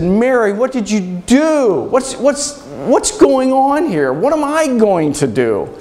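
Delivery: high, drawn-out cry of anger and hurt; anguished yelling. Acted. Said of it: "I was angry, ... I yelled".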